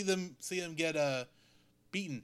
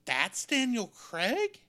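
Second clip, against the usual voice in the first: high, slow, drawn-out amazement at an actor's unrecognisable voice; surprised disbelief; 7 semitones higher, 3 dB louder, slower than usual. Retold or imitated. Recalling watching the film and not recognising Daniel Craig, high slow disbelief. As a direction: retell your stunned reaction, stretching it out high. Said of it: "I'm going".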